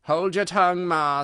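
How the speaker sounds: loud, sharp retort to mother; young crab snapping at her mother; snappish. A narrator's reading. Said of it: "a little snappishly".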